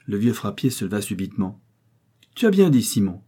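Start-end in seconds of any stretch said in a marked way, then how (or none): 2.4-3.2 louder, higher-pitched jolly approval; cheerful. A narrator's reading. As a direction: chime in gaily. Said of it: "fit-il d'un air gai". (d'un ton gai et enjoué)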